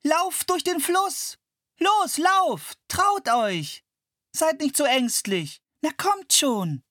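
put-on high girl's voice, eagerly urging animals on; a girl shouting encouragement across the river; cheering girl. A narrator's reading. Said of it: "feuerte die Gnus an".

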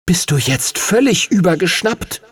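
appalled creature asking if he's lost his mind; indignant dragon. Acted. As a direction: scold him for the crazy stunt. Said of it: "schüttelte empört sein Haupt".

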